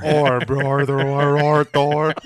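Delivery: slow, mumbled through peanut butter; mouth full of peanut butter. Pretend. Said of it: "Just a mouthful of peanut butter".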